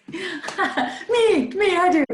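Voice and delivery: high, giggly volunteering; laughing eagerness. Real, not acted. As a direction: jump in with a laugh.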